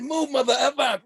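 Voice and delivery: high, loud mock shout; retold shout. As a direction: bark it at the big guy blocking the cake. Shoving a big guy for cake.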